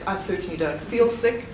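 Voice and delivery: ordinary talk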